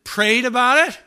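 loud, high indignant bark; loud retort. Retold or imitated. Husband snapping back loudly at his praying wife. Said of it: "Volume's going".

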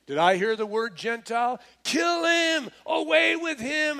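high, loud shout of outrage; outraged crowd baying for blood; angry mob screaming. Acted. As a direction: yell like a furious mob. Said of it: "they start screaming and yelling".

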